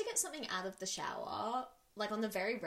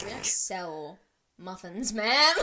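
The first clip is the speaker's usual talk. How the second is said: slow, deliberate, last word punched loud; pointed mock correction; 7 dB louder, much slower than usual. Cupcake worker to customer asking for a muffin, exasperated. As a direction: spell it out, then hit the "ma'am".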